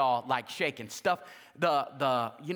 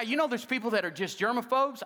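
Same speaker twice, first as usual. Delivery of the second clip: ordinary talk, nothing marked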